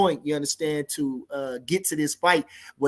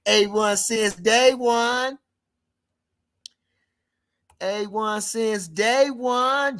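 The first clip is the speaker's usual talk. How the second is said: slow, higher-pitched stretched call; lingering, raised-pitch greeting; drawn-out shout-out; 5 semitones higher, much slower than usual. Real, not acted. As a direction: stretch the words out like a roll call. Calling out a viewer's screen name.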